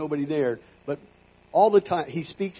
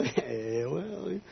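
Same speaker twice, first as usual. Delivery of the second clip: soft, reluctant wavering; hesitant hedging; 2 semitones higher, 10 dB quieter than usual. Retold or imitated. Minister retells dodging God's question, soft hesitant hedge. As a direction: stall quietly, unsure of the answer. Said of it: "I wanted to say the right thing".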